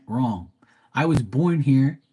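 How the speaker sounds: ordinary delivery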